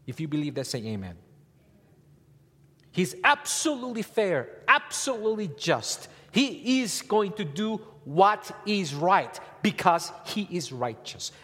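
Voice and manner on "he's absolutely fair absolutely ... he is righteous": high, loud, slow preaching; full-force preaching. Acted. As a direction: proclaim it to the church. Preaching climax.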